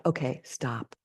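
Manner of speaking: a soft, deliberate stop; quiet self-check. Retold or imitated. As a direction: hush yourself to a halt. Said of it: "Also out loud".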